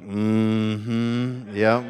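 knowing mock assent; smug agreement. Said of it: "people that grew up in church are like".

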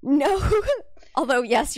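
high laughing burst. Real, not acted. Host laughing at friend's prayer mix-up, high and loud. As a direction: break into a squeaky laugh.

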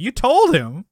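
high, loud disbelief; you told him? really?; incredulous. Real, not acted. Friend recounting Seinfeld plot, loud incredulous outburst.

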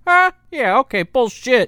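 hammed-up smug partner; mock skeptical Brant. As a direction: call out an obvious lie. Mocking Brant's skeptical face.

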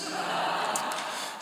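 quiet breathy chuckle; soft laugh. Real, not acted. Speaker laughing at his own glasses mix-up, soft chuckle. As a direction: let a small laugh slip out.

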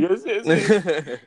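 slow, laughing eager agreement; eager laughing yes. Real, not acted. Eager reply.

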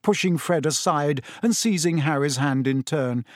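usual speaking voice

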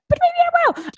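very high, teasing little voice; mischievous aside. Pretend. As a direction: take it back in a playful squeak. Teasing aside after promising not to.

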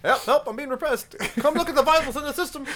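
high-pitched hammed-up cry for help; comic high-voiced protest; Monty Python peasant. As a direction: wail it high like an oppressed peasant. Quoting Python peasant being repressed.

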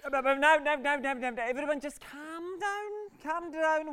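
high, stammering reassurance; anxious appeal for calm; flustered placating. Acted. Soothing a panicking family.

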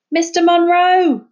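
high, loud, slow call to a distant boat; shouting across the harbour. Acted. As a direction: call out to the fisherman. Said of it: "she shouted".

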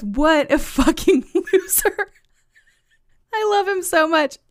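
laughing through the words, pitched high; helpless amusement. Real, not acted. Host gushing over the meme on his phone, helplessly amused. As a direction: break up laughing in delight.

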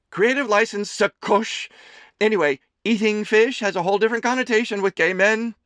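high, loud doting mother voice; a fussing mother teasing her son; Amelie's voice. A narrator's reading. Mother serving her son lunch, high and fussing.

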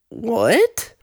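high, drawn-out baffled question; confused disbelief. Retold or imitated. Student turning round at a bizarre request, confused disbelief. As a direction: recreate being totally thrown, pitch shooting up. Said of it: "so confused 'cause it was so out of context".

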